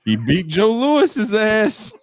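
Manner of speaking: put-on high, loud, drawn-out old man; old barbershop guy. Old man at the barbershop arguing boxing, hammed-up impression. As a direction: crow it slowly like a cantankerous old-timer. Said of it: "you're gonna be the old guy".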